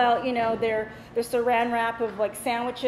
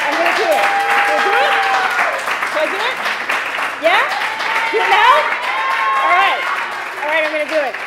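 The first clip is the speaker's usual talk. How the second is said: high, loud shouted rally; hyping the crowd; 6 semitones higher, 8 dB louder, much faster than usual. Real, not acted. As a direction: work the cheering audience up to a dare.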